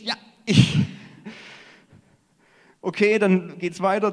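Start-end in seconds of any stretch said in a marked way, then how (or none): 0.0-0.8 loud, high eager "Ja, ich!"; eager volunteer. Acted. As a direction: shout out your hand-up like a keen volunteer.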